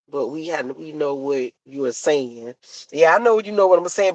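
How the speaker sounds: ordinary talk, nothing marked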